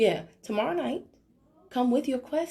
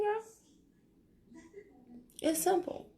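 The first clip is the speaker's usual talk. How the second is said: usual speaking voice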